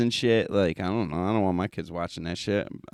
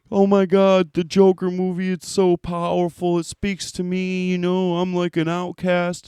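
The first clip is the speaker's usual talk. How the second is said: high, breathless gushing fanboy voice, much higher and louder; mocking corny fan; 11 semitones higher, 5 dB louder than usual. Mocking the Joker fans who feel like outcasts, gushing and high-pitched. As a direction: ham up a swooning fan who thinks the movie speaks to him. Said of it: "corny fucking dorks that, like, were like".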